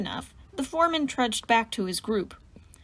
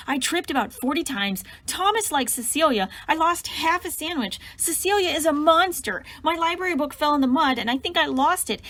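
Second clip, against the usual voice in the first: higher, loud wailing rant through sobs; bawling shout; 5 semitones higher than usual. Acted. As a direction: blurt every woe out crying. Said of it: "bawling outright and loudly shouted".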